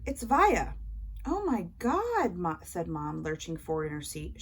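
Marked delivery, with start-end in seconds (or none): 0.1-0.7 excited. A narrator's reading. Retorting in the theatre. 1.2-2.4 slow stunned gasp of surprise; drawn-out, amazed oh my God; surprised. A narrator's reading. Mom surprised, lurching forward.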